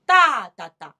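long first 哒 then two quick ones; long-short-short 哒哒哒; back-sixteenth rhythm. Shown as a demonstration. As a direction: lean loudly on the first 哒, clip the next two. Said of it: "这叫后十六".

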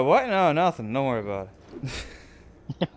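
usual speaking voice

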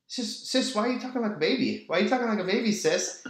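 put-on high, fast little-kid voice; toddler voice. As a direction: play yourself as a tiny kid teasing your sister. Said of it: "and I just go".

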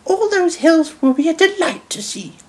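high-pitched grandmother voice; high lady's delight; delighted Dowager Hat. A narrator's reading. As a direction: savour it as a delighted old lady, pitched high. Dowager's quote continues.

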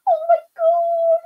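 very high, loud squeals of disbelief; squealing excitement. Real, not acted. Artist squealing over a fan's backstage story, high excited shrieks. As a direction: shriek it out, overwhelmed with delight. Reading a super chat in excitement.

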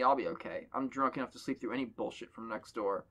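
normal talking voice

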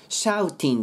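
"Shouting" with a crisp T and I; clear T. Shown as a demonstration. Teacher showing what to avoid, T fully sounded. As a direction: sound "Shouting" as spelled, "shau-tin". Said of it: "Shoutingではなく".

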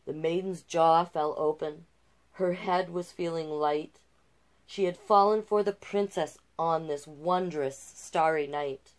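gentle rhyming read-aloud; storyteller voice for a picture book; storybook narration. A narrator's reading. Reads the fairy tale aloud.

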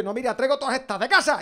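high, shouted Murcian parody; mock Murcian contestant. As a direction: build to a squealing Murcian boast. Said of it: "se van ahorrando consonantes".

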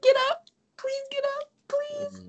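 very high, squeaky begging; mock desperate pleading. Re-enacts pleading while watching film.

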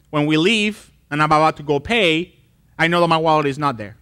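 ordinary talk

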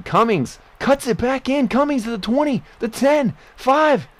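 high, loud rising yell down the field; building from high to loudest at the goal line; excited play call. Real, not acted. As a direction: call every yard as he breaks away. Touchdown run call in game.

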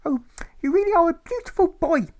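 very high, loud doting mother; fussing over her boy; mother muttering. A narrator's reading. Mother dotes on her son, high and loud. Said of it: "his mother begins to mutter".

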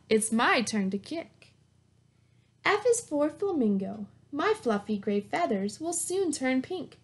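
bright, lively picture-book read-aloud; reading to children. Acted. Teacher reading a zoo alphabet book to her class, lively read-aloud. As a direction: read it like story time for little kids.

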